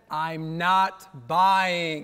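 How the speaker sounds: drawn out, word by word; slow emphatic repeat. Retold or imitated. [name] repeating himself slowly to the pushy salesman. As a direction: spell it out slowly, fed up. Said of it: "when I said".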